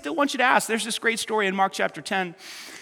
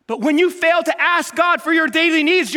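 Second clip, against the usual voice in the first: usual speaking voice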